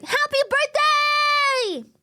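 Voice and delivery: high, slow shouted birthday call. Real, not acted. Repeated high shout greeting.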